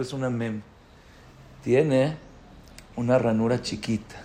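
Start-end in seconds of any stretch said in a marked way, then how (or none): none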